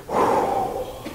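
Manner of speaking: long acted whew; shaken exhale. Minister alone in his car after the prayer, shaken exhale. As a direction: blow out a long unvoiced breath.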